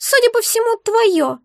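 very high, squeaky, put-out creature; upset. A narrator's reading. Egg answers whose it is, upset. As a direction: squeak it in dismay. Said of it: "огорчённо отозвалось существо".

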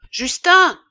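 high, loud call for Justin; impatient shout. A narrator's reading. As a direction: call out sharply, losing patience. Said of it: "cria l'apothicaire qui s'impatientait". (cri impatient)

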